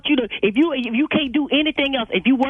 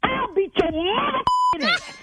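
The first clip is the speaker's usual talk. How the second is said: furious outburst; 12 semitones higher, slower than usual. Real, not acted. Owner explodes at the caller.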